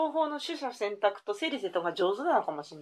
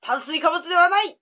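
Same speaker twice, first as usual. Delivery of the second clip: excited exclaiming; 6 semitones higher, 6 dB louder than usual. Acted. Quiz clues exclaimed.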